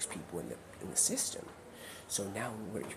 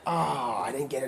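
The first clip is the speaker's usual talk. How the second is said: mock dismay; 6 semitones higher, 6 dB louder than usual. Acts out missing a catch.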